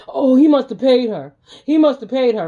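high, loud sing-song sneer; loud mock whine of the gossiping men. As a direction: parrot their gossip to make it sound dumb. Mocking the men's accusation.

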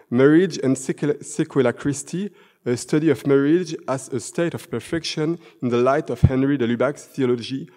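English title read in a thick French accent; self-mocking French-accented English. Shown as a demonstration. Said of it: "c'était la pause avec mon accent français magnifique".

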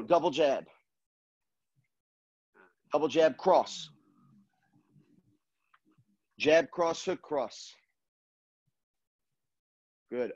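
punchy combo calls between pauses; boxing coach calling combos. Acted. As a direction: call each combo crisply, like a coach. Live combo calling in class.

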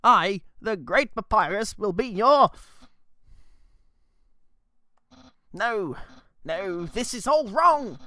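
put-on grand self-introduction in a light British accent; British Papyrus. Papyrus introduces himself in a slipping British accent. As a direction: announce yourself like a pompous British skeleton. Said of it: "It's British again".

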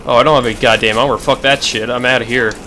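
ordinary talk

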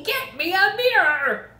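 yelling. A narrator's reading. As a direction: yell it in rising panic. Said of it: "the father yelled".

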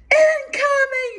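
very high, loud, drawn-out mock scream; imitated scream. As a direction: imitate a friend screaming a warning. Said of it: "she'd scream out".

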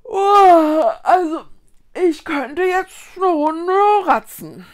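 loud, high yawn carried through the words; comfortable stretching yawn. A narrator's reading. Child stretches out after the picnic, yawning contentedly. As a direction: stretch and yawn while talking, drawn out. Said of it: "streckte Vicky sich behaglich aus".

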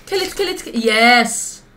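panicked cheering on; frantic cheering. Real, not acted. Urging wife in fight.

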